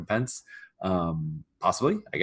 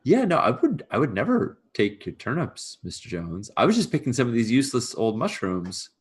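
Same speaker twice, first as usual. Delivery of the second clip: higher-pitched, halting denial; nervous servant excuse; 4 semitones higher than usual. Acted. Servant denies taking farmer's turnips, nervous. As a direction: talk your way out, voice lifted.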